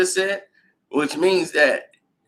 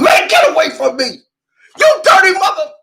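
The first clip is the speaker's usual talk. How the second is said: a much higher, loud yell with a cry in it; furious shout; 12 semitones higher, 8 dB louder than usual. Retold or imitated. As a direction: scream him off in a rage. Player yelling at everyone.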